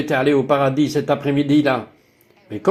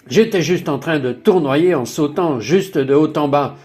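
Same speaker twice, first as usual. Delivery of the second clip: normal talking voice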